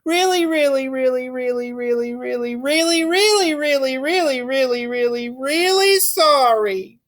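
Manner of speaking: put-on high, pleading little-girl voice, slowing at the end; earnest child apology. Lily apologizes to her teacher, high pleading child voice. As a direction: beg forgiveness like an eager kid. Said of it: "Lily said to Mister Slinger".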